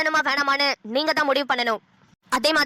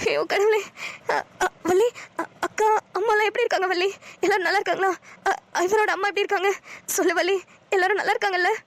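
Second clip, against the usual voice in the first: higher, urgent questions about family; anxious, close to tears; 5 semitones higher than usual. Acted. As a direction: beg for news of her mother. Exiled woman anxious for family. (பதற்றமான, அழுகை கலந்த குரல்)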